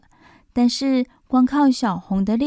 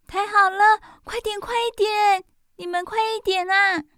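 urgent; 9 semitones higher than usual. Acted. Urging fire truck in emergency.

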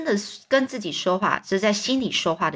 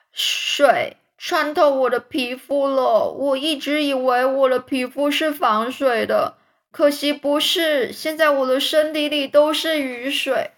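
complaining; 5 semitones higher, 4 dB louder than usual. A narrator's reading. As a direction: whine it out as a put-upon earthworm. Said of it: "他可以开始抱怨地说". (拉高嗓子抱怨)

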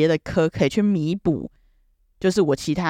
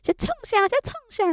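grandma's high nagging. Retold or imitated. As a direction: nag in a thin high grandma voice. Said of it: "然后她说".

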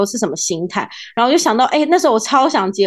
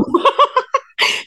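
bright laugh; high giggle. Real, not acted. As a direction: giggle at the joke. Joke about photo editing. (咯咯笑)

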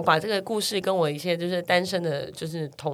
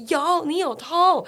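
higher, slow mock-angry insistence; angry kid; 9 semitones higher, much slower than usual. Little brother accusing big brother, mild mock anger. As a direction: stretch it out like a cross little kid. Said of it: "弟弟就很生气".

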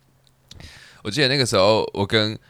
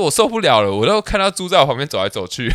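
a mom's loud, dramatic griping about pig smell, retold and half-hammed; imitating a mother complaining loudly at the top of her voice; mock loud complaint; 5 semitones higher, 5 dB louder than usual. Mom complains to the ramen waiter, in a mock loud rant. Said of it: "然后讲超大声的那种".

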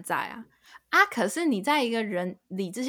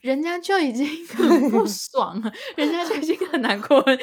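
louder, rising lines broken with real laughter; laughing protest; 6 semitones higher, 6 dB louder than usual. Real, not acted. Friend teasing her co-host about blunt advice, laughing and louder. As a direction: laugh through the point as you push it.

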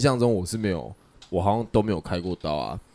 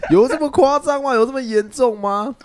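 incredulous; 6 dB louder than usual. Retold or imitated. Baffled outrage at scolding.

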